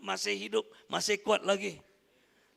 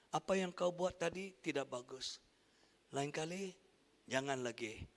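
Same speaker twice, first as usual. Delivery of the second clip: firm calm rebuke; 6 semitones lower, 8 dB quieter than usual. Retold or imitated. Firm rebuke contrasted with anger.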